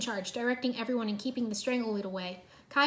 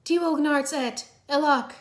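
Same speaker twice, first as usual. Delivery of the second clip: higher, louder, slow backwards words; shouted backwards talk; 5 semitones higher, 6 dB louder, slower than usual. A narrator's reading. Zane shouts a garbled warning to Cole, loud. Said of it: "he shouted".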